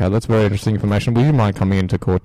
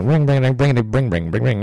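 mock ringtone; 4 semitones higher than usual. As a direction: bounce it like a silly ringtone. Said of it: "very interesting ringtone".

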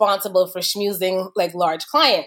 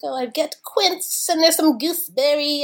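lightly put-on pretentious wine snob; mock tasting-note voice; sommelier voice; 6 semitones higher than usual. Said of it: "I make that voice when I make fun of wine".

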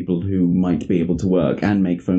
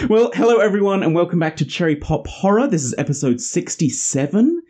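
bright, higher welcome to listeners; podcast host intro; 7 semitones higher than usual. Acted. Podcast host welcoming listeners back to the show. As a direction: open the show like a host greeting the audience.

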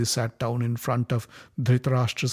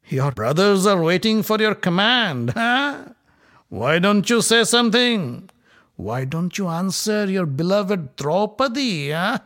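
loud, higher sneering taunt; mocking Duryodhana; 7 semitones higher, 5 dB louder than usual. A narrator's reading. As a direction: goad the silent king.

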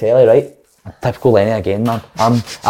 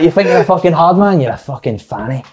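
put-on Lenny's accent; 5 semitones higher than usual. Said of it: "I can't do the accent".

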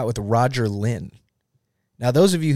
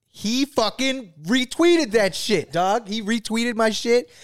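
excited; 11 semitones higher, slower than usual. Real, not acted. Thrilled at the retweet.

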